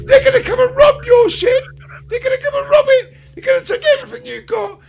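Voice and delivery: high, loud ranting half-laughing. Real, not acted. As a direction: shout it through laughter. Ranting at preppers.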